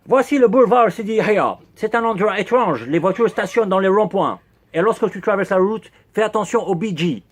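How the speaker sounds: French spoken with a heavy, hammed-up English accent; horrible English accent. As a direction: play Mike as an Englishman mangling French. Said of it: "Quel horrible accent anglais".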